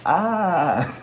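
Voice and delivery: high, loud mock falling scream. Falling off the cliff into the river, high mock scream. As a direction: yelp like you're dropping off a cliff. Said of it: "I'm just like".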